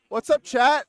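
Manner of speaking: loud streamer greeting; excited. Real, not acted. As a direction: pump up the chat. Opening the live stream greeting.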